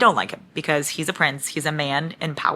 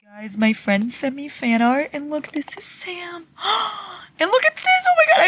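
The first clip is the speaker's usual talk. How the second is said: gasping, overwhelmed fan delight; excited squeal; 11 semitones higher than usual. Real, not acted. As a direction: voice climbing into a high squeal. Seeing fan art, gasping.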